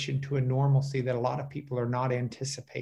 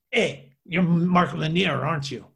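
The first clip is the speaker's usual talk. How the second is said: put-on thick Spanish accent; 2 semitones higher, 4 dB louder than usual. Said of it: "with a really thick, uh, Spanish accent".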